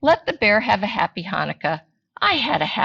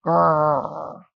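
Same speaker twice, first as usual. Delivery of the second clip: bear growl; 2 semitones lower than usual. Acted. Bear answers.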